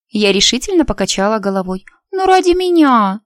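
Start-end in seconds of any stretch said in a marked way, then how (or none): 2.1-3.2 high, loud begging; pleading girl. A narrator's reading. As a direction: beg with a little whine. Лия begging her friend.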